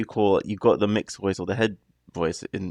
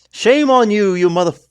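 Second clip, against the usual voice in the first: loud, high, furious shout; angry outburst; 11 semitones higher, 10 dB louder than usual. Real, not acted. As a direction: yell it in sudden rage. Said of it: "if I have another outburst".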